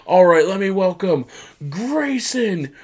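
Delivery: high announcer call, name stretched out long; hammed-up high-pitched introduction; mock ring introduction. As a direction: announce a wrestler, dragging out the name. Imitating wrestler's ring introduction.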